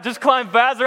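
high, loud mocking repeat; incredulous echo. Panicked climber throwing his brother's advice back, incredulous.

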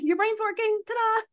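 very high, sing-song mock triumph; playful ta-da. Telling worried meditators their thinking is fine, high sing-song ta-da. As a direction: present it like a magic trick reveal.

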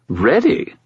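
high incredulous spirit voice; Bob skull voice. A narrator's reading. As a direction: scoff at the question. Bob scoffs.

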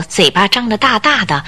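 normal talking voice

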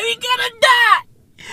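high, shouted mock terror; hammed-up panic cry. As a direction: yell it like doom is here. Comic panic over jet fuel.

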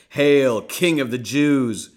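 loud, slow jeering hail; mocking shout. Soldiers jeering at the crowned prisoner, loud mock hail. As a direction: hail him in loud mockery.

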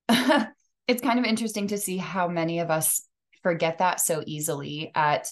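ordinary talk, nothing marked

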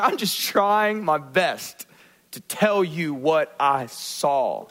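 normal talking voice

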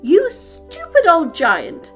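exasperated giantess; scolding wife. A narrator's reading. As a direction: tell him off like a fed-up wife. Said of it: "said his wife".

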